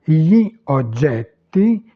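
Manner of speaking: slow "gli oggetti", cut into two words; words separated. Shown as a demonstration. Teacher showing beginners how to start out, words separated. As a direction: pull "gli" and "oggetti" apart, slowly. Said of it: "vous les séparez".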